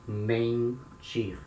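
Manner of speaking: mentir with a nasal e before the n; nasal sound in mentir. Shown as a demonstration. Teacher to students, modelling mentir with a nasal vowel. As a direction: push the en of mentir through the nose. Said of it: "the sound that comes from the nose".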